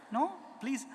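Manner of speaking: ordinary talk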